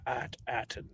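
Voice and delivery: low, very soft pirate rasp. Pretend. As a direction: mutter it under your breath like a pirate. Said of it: "a pirate voice".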